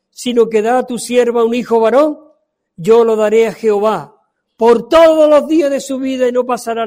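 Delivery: loud, high, fervent scripture reading; fervent preaching. Acted. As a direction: cry out the vow before the congregation. Reads Hannah's vow. (lectura ferviente y en voz alta del voto de Ana)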